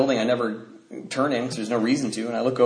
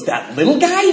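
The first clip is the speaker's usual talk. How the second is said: mocking disbelief; 11 semitones higher, 4 dB louder than usual. Dad mocks the small kid.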